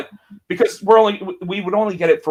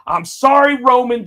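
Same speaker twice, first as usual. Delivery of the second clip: louder, higher mocking apology; raised voice; 8 semitones higher, 8 dB louder than usual. Imitating Punk raising his voice to insult Roman. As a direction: raise it, sneering sorry. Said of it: "he raised his voice to say".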